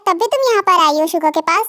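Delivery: usual speaking voice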